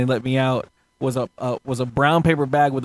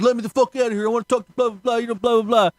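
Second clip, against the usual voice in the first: mock rowdy drunk; 7 semitones higher, faster than usual. Retold rowdy inmate.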